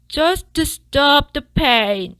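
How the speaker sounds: slow, high, loud whimpered plea; whimpering. A narrator's reading. Fox whimpering at the dentist, slow and high. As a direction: whimper it through the pain. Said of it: "whimpered the fox".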